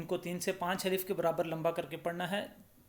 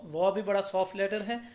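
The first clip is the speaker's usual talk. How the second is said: ordinary delivery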